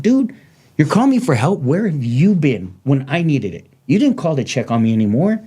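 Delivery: retold indignant outburst, starting high; pissed off. Injured man to friends calling for help, pissed off. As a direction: demand to know where they were, aggrieved. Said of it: "And I was pissed. I was like".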